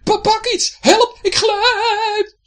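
high, loud stammering cry, stretched slow; panicked slipping. Acted. Pirate sliding off the deck, loud fear. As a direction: wail as you slide away.

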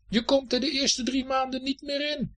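high, sustained calling-out; angry shout after someone. A narrator's reading. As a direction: shout it after them as they leave. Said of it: "schreeuwde Herfst hem nog na". (hoog en kwaad naroepen)

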